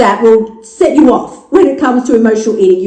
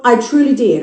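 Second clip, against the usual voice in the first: usual speaking voice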